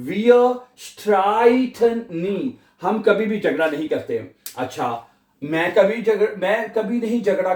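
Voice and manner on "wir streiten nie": streiten with ei said as ai, slow and clear. Shown as a demonstration. Teacher showing German ei pronunciation, slow. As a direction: stretch the ai in streiten. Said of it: "आई पढ़ा है हमने इसको".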